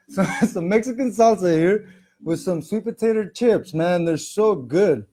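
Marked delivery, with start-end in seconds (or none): none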